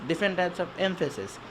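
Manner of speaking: usual speaking voice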